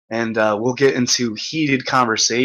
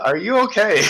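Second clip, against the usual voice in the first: concerned; 9 semitones higher than usual. Retold or imitated. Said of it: "It's like".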